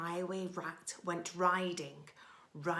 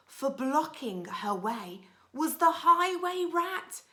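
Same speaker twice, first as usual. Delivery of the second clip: building tension; 8 semitones higher, 8 dB louder than usual. A narrator's reading. Building to the rat's entrance.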